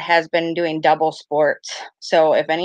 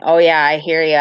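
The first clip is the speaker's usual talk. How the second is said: usual speaking voice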